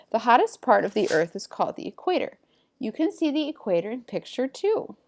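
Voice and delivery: lively, emphatic textbook reading; animated classroom read-aloud with lifted key words; reading aloud to pupils. Acted. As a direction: read the facts out clearly to a class. Reads textbook passage aloud to pupils.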